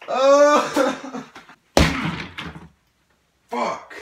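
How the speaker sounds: high loud laugh then curse; real laughing shock. Real, not acted. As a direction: laugh out in disbelief. Reacting to wrong answer.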